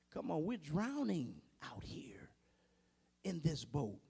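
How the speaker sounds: whispered panic; urgent whisper. Pretend. Frightened disciple whispers to sleeping Jesus. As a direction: hiss it like a scared sailor.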